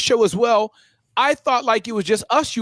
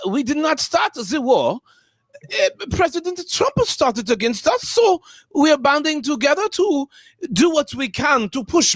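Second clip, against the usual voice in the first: a light put-on European accent, earnest and defensive; imitating a European official on the news; 3 semitones higher than usual. Retelling a European interviewer's answer in a mock accent. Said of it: "one of those countries where they have a, an accent".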